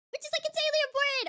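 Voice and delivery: high and rushed insistence; excited. Real, not acted. Fan repeating her point fast and excited. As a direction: rattle it off, worked up.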